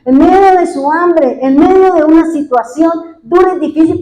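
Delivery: loud, high, insistent pulpit questions; preacher pressing the congregation with why after why; fervent sermon climax; preaching at full force. Acted. Preacher's rising rhetorical questions.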